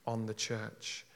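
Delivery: ordinary delivery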